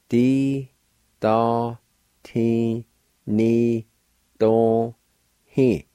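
"Di, da, ti, ni, do, he" one syllable at a time; long, separate syllables in "Di, da, ti, ni, do, he"; sounded out. Shown as a demonstration. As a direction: pause after every syllable of "Di, da, ti, ni, do, he". Teacher sounds out word for captain.

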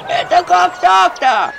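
high, loud, slow wail; lamenting. A narrator's reading. The village laments to the healer, wailing. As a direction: wail in dismay. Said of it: "запричитала деревня".

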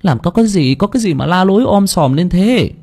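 high, put-on female voice; flustered sister. A narrator's reading. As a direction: scold in alarm, pitched high. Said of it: "vẻ mặt hốt hoảng". (giọng chị gái cao, hốt hoảng)

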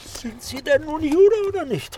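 high loud pressing question; eager, loud prodding; insistent demand. Acted. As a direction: push him for the answer.

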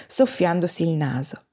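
ordinary delivery

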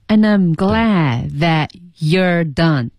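"And I'm glad that you're done" very slow, reduced to un-num, yer; slow-motion linking of And I'm glad that you're done, D and T unreleased; careful learner's practice of the weak forms in and I'm glad that you're done; many weak forms. Shown as a demonstration. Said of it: "对，就特别多的弱读".

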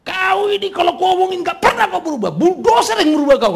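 put-on high, loud scolding yell at a child; angry parent. Angry parent yelling at a child who never changes. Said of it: "kalau lagi marah sama anaknya".

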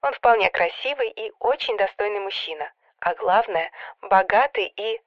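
sister prattling over the phone; phone-filtered matchmaking chatter; mild chattering. A narrator's reading. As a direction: forget caution, gush down the line. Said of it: "протараторила".

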